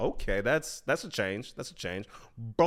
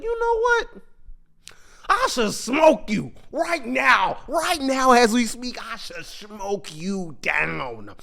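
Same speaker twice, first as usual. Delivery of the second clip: menacing threat; 5 semitones higher, slower than usual. Acted. Mark on the edge, threatening the dinosaur man, slow menace. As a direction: let the anger simmer into a threat.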